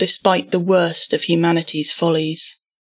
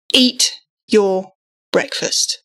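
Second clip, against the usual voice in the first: stern; slower than usual. A narrator's reading. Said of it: "Mum raised her voice".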